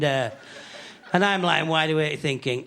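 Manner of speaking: ordinary talk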